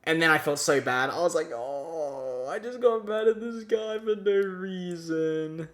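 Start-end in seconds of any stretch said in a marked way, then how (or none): 1.5-5.7 guilty. Retold or imitated. Guilty realization quote.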